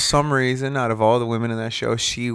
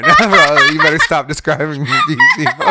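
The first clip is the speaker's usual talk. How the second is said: laughing protest, louder, higher at the end; teased friend laughing while protesting; 5 semitones higher, 9 dB louder than usual. Real, not acted. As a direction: talk through real laughter.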